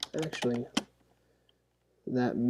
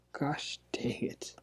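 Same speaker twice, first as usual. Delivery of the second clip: Gosh dang it whispered slowly, voiceless; 10 dB quieter, slower than usual. Real, not acted. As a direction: hiss Gosh dang it under your breath, slowly. Muttering at a drawing mistake.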